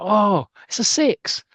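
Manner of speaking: high, loud, drawn-out cheer; excited cheer. Acted. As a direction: marvel at the shot, stretching it out. Spectators cheering a big hit.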